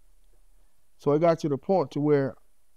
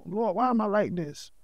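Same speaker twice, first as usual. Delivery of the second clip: pleading prayer; 4 semitones higher than usual. Retold or imitated. Retold prayer in mirror.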